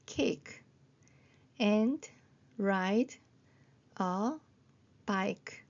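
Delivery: very slow, a pause after each word; calm word-by-word reading. Shown as a demonstration. Teacher reading along with nervous learners, calmly word by word. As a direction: sound out one word at a time. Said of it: "차분하게 같이 읽어보겠습니다".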